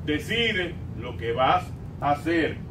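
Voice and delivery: ordinary delivery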